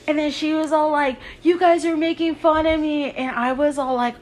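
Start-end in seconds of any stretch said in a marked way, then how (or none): 1.4-3.1 high-pitched hurt imitation; retold complaint, pitched up; mock wounded protest. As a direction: imitate a girl accusing friends of mocking her. Girl protests being laughed at.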